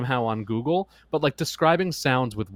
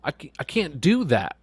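higher-pitched mock exasperation; exasperated parent; 5 semitones higher than usual. Parent protesting a child's animal requests, exasperated.

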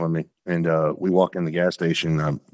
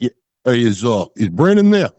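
mob-style caller; 4 semitones higher, 5 dB louder than usual. Retold or imitated. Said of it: "I watch a lot of mob movies".

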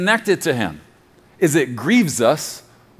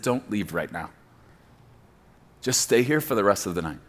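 tender invitation; 8 semitones lower, 8 dB quieter than usual. Retold or imitated. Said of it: "he said".

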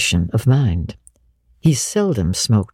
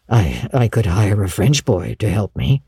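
low, tentative man; tentative Matthew figure; 5 semitones lower than usual. Acted. Matthew suggesting a way to keep her, tentative. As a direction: offer it haltingly, pitched low.

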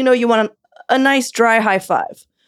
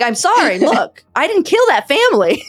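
higher, hammed-up protest of innocence; mock defensive; 6 semitones higher, 4 dB louder than usual. Co-host answering the beaver accusation, mock defensive. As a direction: plead innocence in playful indignation.

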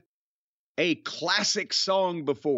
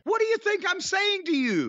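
high-pitched, impatient retort; exasperated; 12 semitones higher than usual. Real, not acted. Host arguing over the date with co-host, exasperated. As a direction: push back in exasperated disbelief.

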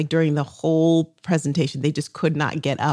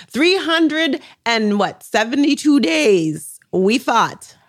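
dramatic pride; 8 semitones higher, 4 dB louder, slower than usual. Acted. Triumphant dramatic recount.

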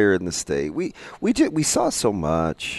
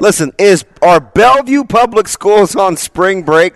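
loud call-out; 11 semitones higher, 12 dB louder than usual. Real, not acted. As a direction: shout the question out to the room. Calling out a question loudly.

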